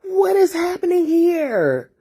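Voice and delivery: high, tearful half-laughing cry retold; a shaken plea, voice breaking upward; distraught plea. Alone in the car, overwhelmed, crying out in tears. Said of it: "I f-, I was like".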